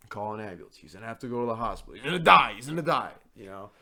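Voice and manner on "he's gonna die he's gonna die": mock panic.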